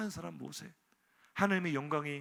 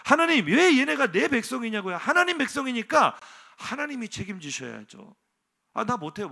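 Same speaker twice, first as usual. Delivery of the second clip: loud indignant complaint, pitched up; Moses protesting; 8 semitones higher, 9 dB louder than usual. Acted. Moses to God, refusing the burden, loud and exasperated. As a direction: throw the responsibility back, exasperated.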